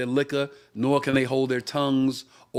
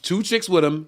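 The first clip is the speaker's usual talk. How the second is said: usual speaking voice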